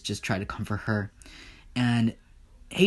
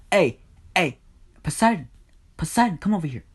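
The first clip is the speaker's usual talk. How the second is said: higher-pitched summons to someone away; calling out; 7 semitones higher, 4 dB louder, faster than usual. Acted. Hades calls Poseidon up as witness, calling out. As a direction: call him over in short bursts. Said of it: "he just calls Poseidon up".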